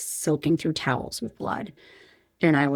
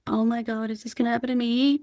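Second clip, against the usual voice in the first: a higher, worried mock gasp of the pregnant women; imitating scared women; 7 semitones higher than usual. Pregnant women passing the gurney, mock worried voice. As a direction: imitate their dread, voice lifted and changed. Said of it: "pregnant women who were like".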